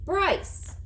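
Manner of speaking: high sharp call of a name; shouting. Acted. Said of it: "shouted the old woman".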